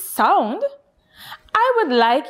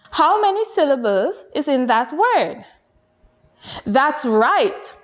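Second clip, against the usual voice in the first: usual speaking voice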